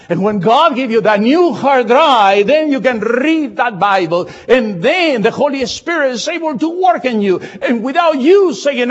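loud, high, climbing sermon voice; fiery preaching. Acted. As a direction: preach it out at full force. Pastor preaching at full force.

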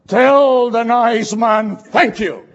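loud, slow mother's command with a laugh; insistent mother. Retold or imitated. A mother ordering her child to thank the man, loud and slow. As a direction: spell it out firmly like an embarrassed parent.

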